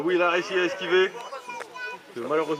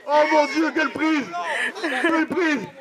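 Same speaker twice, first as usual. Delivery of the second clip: excited commentator; 9 semitones higher, slower than usual. Real, not acted. Live commentary on huge move.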